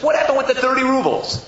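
high, insistent question; pointed demand. Acted. Maggid confronting Zusha about the money, demanding. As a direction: demand an explanation.

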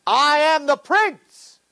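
slow, high, loud shout; young prince proclaims himself to the guard, indignant shout; indignant proclamation. Retold or imitated. Declare it high and drawn out. Boy insists to doubting guard.

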